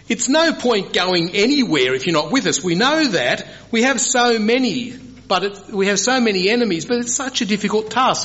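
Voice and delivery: high-pitched, pleading objection; Moses protesting. Retold or imitated. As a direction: play Moses protesting that the task is too hard. Preacher acts Moses objecting to God.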